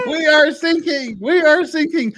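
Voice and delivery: high, loud mock German coast guard; loud, high-pitched parody of the sinking-ship ad; quoting the ad. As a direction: shout the ad line in a hammed-up foreign voice. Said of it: "most beautiful commercial ever".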